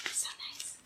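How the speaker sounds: soft unvoiced whisper. Real, not acted. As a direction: breathe it as a private aside. Aside to the Bible reader.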